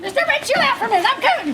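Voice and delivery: high, worked-up surfer-dude holler; frantic calling out. Acted. Late surfer afraid of being left behind, calling out excitedly. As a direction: burst in, begging them to hold on.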